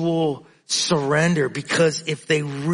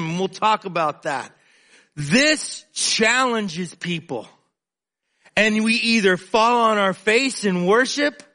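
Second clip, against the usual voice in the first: raised, insistent pulpit voice; fervent preaching; 5 semitones higher than usual. Acted. Preacher challenging the congregation, fervent and raised. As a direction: press the point home to the congregation.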